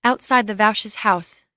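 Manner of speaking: ordinary talk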